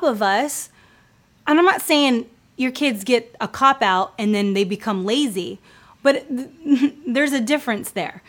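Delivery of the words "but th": usual speaking voice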